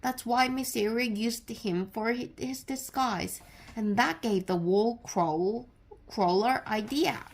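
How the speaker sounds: ordinary delivery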